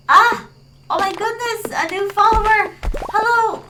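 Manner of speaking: high, loud excited greeting; excited squeal of welcome; delighted surprise. Real, not acted. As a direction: burst out thrilled to welcome a newcomer. Greeting a surprise new follower.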